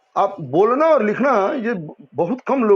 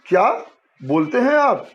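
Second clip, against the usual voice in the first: questioning क्या, a pause, then बोलते हैं आप higher; pause after क्या; 5 semitones higher than usual. Shown as a demonstration. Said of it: "ऐसे भी बोल सकते हैं". (क्या के बाद ठहराव, बोलते पर ऊंचा स्वर)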